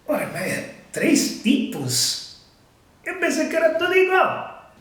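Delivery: high-pitched incredulous protest; mock surprise. Learner objecting to three pronunciations, mock surprised. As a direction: act out a baffled learner objecting.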